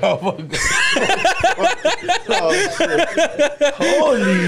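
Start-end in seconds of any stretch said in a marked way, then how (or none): 0.4-4.5 oh shit, cracking up; laughing hard. Real, not acted. Reacting to a friend's story, laughing hard. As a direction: burst out laughing mid-word.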